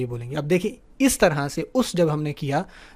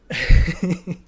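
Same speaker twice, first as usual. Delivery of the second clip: short laugh; 5 dB louder than usual. Real, not acted. Teacher laughs at own slip.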